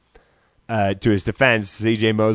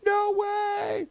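high, cracking cry of disbelief; stunned disbelief; 7 dB quieter than usual. Retold or imitated. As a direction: gasp it out like you can't believe it. Retold disbelief.

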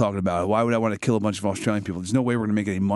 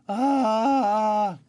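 high squawk; 11 semitones higher, 3 dB louder than usual. Acted. Character exclaiming.

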